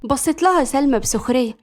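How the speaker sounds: ordinary delivery, nothing marked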